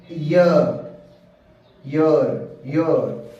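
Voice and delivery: ईयर repeated, first one loud; teacher repeats ईयर so the class hears the y sound; pronunciation drill. Shown as a demonstration. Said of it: "तो ए".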